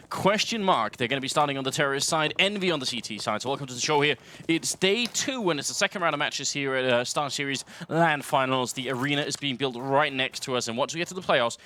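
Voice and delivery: lively esports caster call; play-by-play commentary. Acted. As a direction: call the action live. Live play-by-play casting.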